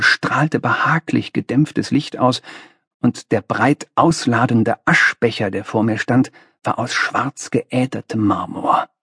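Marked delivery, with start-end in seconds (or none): none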